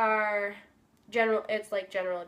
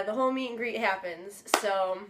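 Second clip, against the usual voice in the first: normal talking voice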